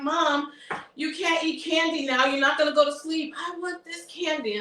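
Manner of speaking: high-pitched, rapid scolding of mom; exasperated protest. Retold or imitated. Daughter scolding her mother over candy in bed, high and exasperated. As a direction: pitch it up, fast, fed up with her eating candy.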